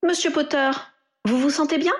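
a nurse leaning in to ask after him; nurse's voice. A narrator's reading. Nurse asking the waking patient Harry how he feels, lightly voiced. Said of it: "une infirmière parut".

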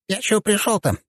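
very high, small character voice; little deity. A narrator's reading. Small deity getting to the point, casual high voice. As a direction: speak in a tiny high-pitched voice. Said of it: "проговорило божество".

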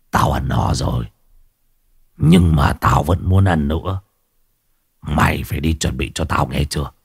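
low rasping demand from something not quite human; strange raspy voice. A narrator's reading. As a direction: speak like a stranger wearing the husband's body. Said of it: "Giọng của lão vang lên đầy xa lạ".

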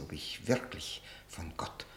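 a breathless whisper to close the line. Acted. The accused questions the prosecutor's certainty, in a whisper. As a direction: drop to a whisper on the doubt. Doubting his own damnation.

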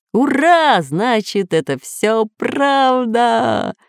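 high, drawn-out exultation; jubilant. A narrator's reading. Professor rejoices that it is all true, high jubilant cry. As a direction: cheer as if a dream came true. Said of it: "ликовал профессор".

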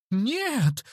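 high, frightened squeal; snivelling denial. A narrator's reading. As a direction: squeal it like a cornered coward. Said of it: "хлюпнул тот". (испуганно хлюпнуть)

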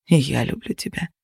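soft, low whisper. Acted. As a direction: breathe it back quietly. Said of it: "прошептали мне".

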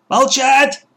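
high, loud cry for silence; shouted command. A narrator's reading. Woman silences whispering captives, shouting. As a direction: shout it sharply to shut them up. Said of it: "опять закричала женщина".

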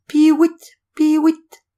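high, loud whistled bird call; shrill bird whistle. Acted. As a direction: imitate a bird decoy piping. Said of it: "le même sifflement". (sifflement aigu d'appeau)

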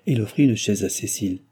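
usual speaking voice

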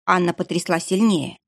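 ordinary delivery

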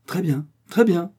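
voix plus haute et plus forte, sonore; lancé avec éclat, derrière les enfants. A narrator's reading. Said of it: "fit soudain une voix sonore". (le père surprend les enfants, voix sonore et joyeuse)

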